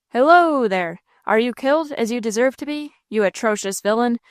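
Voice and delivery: plain reading voice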